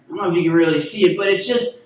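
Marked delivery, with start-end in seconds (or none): none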